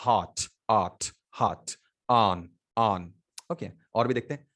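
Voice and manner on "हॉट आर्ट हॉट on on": slow "हॉट" and "on" with an open aw vowel; vowel sound demo. Shown as a demonstration. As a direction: drill "हॉट, on" slowly, word by word, low in pitch. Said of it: "तो आ".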